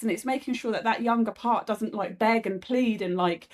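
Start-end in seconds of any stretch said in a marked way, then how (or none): none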